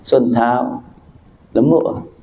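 ordinary delivery

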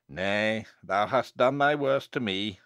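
ordinary delivery, nothing marked

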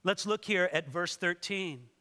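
ordinary delivery

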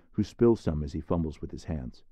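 usual speaking voice

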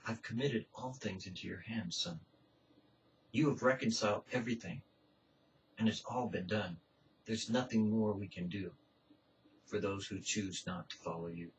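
solemn hushed Father. Acted. The Father telling the Son it is done, low and hushed. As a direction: speak low and final like a judge.

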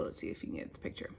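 plain reading voice

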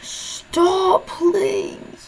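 high, drawn-out whine; whining plea. Real, not acted. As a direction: whine it slowly, begging. Begging the game to stop failing.

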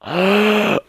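high, drawn gasp of amazement; awed gasp. Acted. Vlogger first seeing the controller, awed gasp. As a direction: gasp at the big reveal.

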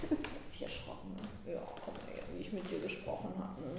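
leise, fast geflüstert trösten; flüstere dem Kind beruhigend zu; tröstendes Flüstern. Acted. (Mutter tröstet das Kleinkind im Flüsterton)

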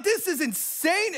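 high-pitched, drawn-out incredulity; stunned disbelief. Retold or imitated. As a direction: re-enact being utterly thrown. Retells his disbelief.

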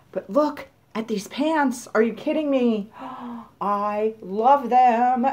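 high, slow squeal of delight; gushing over new leggings; thrilled. Real, not acted. Vlogger squeals at skull leggings from her friend.

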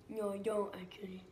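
hushed unvoiced reply; whispering. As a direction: whisper it back, almost secret.

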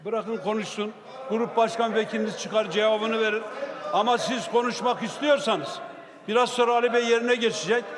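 loud, high-pitched scolding from the chair. Real, not acted. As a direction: call over the hecklers to restore order. Chair rebukes shouting MPs from the podium. (yüksek sesle, sertçe azarlama)

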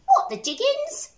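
tiny bees gasping in shock; gasped surprise. Acted. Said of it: "gasped all the little bees".

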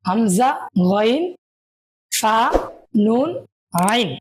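letter drill with throat pressure; reciting letters. Shown as a demonstration. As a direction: press each letter out for students to echo. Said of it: "চাপ দিয়ে বলুন". (চাপ দিয়ে হরফ পাঠ)

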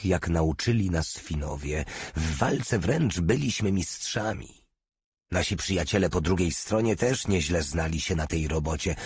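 ordinary delivery, nothing marked

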